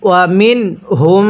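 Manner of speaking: মিন ending in a distinct, unblended n; clear nun sakin. Shown as a demonstration. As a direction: chant মিন with clear n, then a long-held হুম. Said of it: "নুন সাকিনটাকে স্পষ্ট করে পড়ব".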